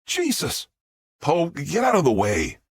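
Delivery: high jolt, then irritated order; jump, then order it aside; startled exclamation. A narrator's reading. Jack orders the robot out of the way, startled.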